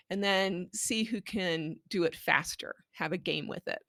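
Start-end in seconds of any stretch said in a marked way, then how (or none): none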